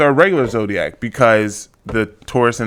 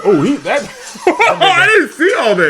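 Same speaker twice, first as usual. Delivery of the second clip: laughing disbelief; 13 semitones higher than usual. Real, not acted. Friend watching the courtroom attack video, laughing in disbelief. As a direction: laugh in amazement, voice shooting up.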